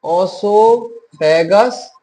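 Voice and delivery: Oesophagus stretched out slowly with a rising end; teacher slowing oesophagus right down so students can repeat it; slow drawn-out oesophagus. Shown as a demonstration. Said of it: "क्या बोलते हैं इसको?".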